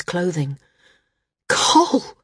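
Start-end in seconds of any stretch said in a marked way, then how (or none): 1.5-2.2 high breathy gasp of a name; horrified cry. A narrator's reading. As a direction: cry out in horror. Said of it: "Maris said, horrified".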